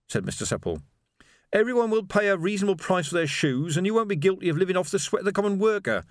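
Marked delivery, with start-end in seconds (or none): 1.5-6.0 high, curt revolutionary voice; short. A narrator's reading. Revolutionary answering the shoemaker's query on payment, short. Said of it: "said Reg shortly".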